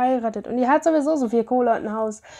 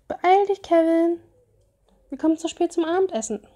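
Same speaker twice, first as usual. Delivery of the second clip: calling out to hurry; 6 semitones higher than usual. Acted.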